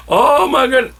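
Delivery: gasp, then high, loud, drawn-out thrill; hammed-up delighted astonishment; overjoyed gasp. Opening the surprise present at the end, hammed-up thrilled gasp.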